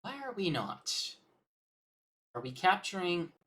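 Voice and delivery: normal talking voice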